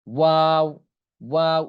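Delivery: ওয়াও drawn out with both lips rounded. Shown as a demonstration. Teacher showing learners the Arabic letter, ওয়াও with rounded lips. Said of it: "মুখ দুইটা গোল হয়ে যাবে".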